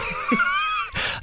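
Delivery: high shrieking laugh; burst of laughter. Real, not acted. Laughing at own inability.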